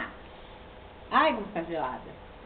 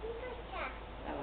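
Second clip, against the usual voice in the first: 1 slow high coo; 11 semitones higher, 9 dB quieter, much slower than usual. Real, not acted. Mostrando à bebê.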